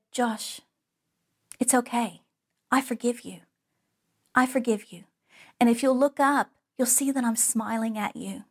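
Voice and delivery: soft, gentle teacher voice with long pauses; tender forgiveness. Retold or imitated. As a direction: softly reassure a crying child, leaving space between phrases. Teacher comforts the crying boy.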